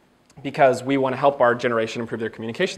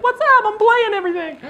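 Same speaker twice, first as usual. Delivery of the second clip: put-on much higher, playful kid; goofy kid voice. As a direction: voice the excited little kid. Voicing the kid in photo.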